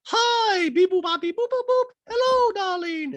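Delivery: very high squeaky voice with beep boop robot noises; high mock robot Dorit. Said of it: "And Dorit's like".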